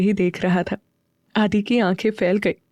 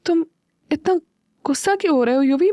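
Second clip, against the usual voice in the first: nervous; 6 semitones higher, faster than usual. Acted. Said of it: "घबरा गई".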